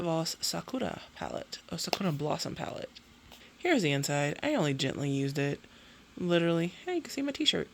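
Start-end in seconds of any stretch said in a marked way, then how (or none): none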